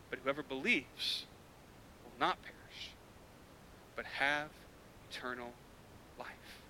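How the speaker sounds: very soft, low reading of the promise; a tender, fading recital; hushed verse. Real, not acted. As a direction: let the verse fall to a near hush. Quoting verse softly.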